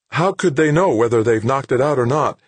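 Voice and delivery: high, loud, insistent question; indignant, high-pitched challenge; demanding. A narrator's reading. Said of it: "Anson demanded".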